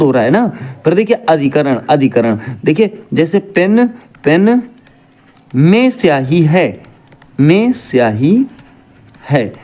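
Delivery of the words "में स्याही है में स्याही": में stressed and drawn out, slow. Shown as a demonstration. Teacher to students, stressing में in में स्याही slowly. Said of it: "में का प्रयोग किया गया है".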